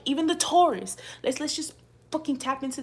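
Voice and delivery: high, loud outburst; shout. Real, not acted. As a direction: yell it out in disbelief. Ranting at a viewer.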